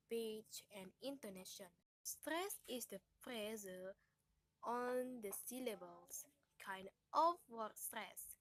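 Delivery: ordinary talk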